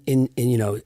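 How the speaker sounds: usual speaking voice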